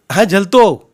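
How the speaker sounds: high, loud answer; shouted reply. A narrator's reading. Uncle ready to catch the bundle, shouting. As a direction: holler back readiness.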